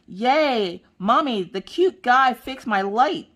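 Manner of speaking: put-on high, slow, sing-song little girl. Daughter Maggie telling Mommy about the light, high excited little girl voice. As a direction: gush like an excited eight-year-old. Mother voices her eight-year-old daughter.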